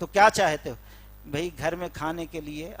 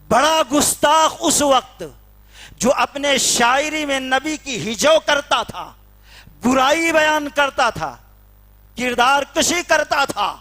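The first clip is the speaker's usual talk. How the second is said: preaching at full force; 8 semitones higher, 11 dB louder than usual. Acted. Preacher denouncing the blasphemer to the crowd, thundering. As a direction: thunder it from the pulpit.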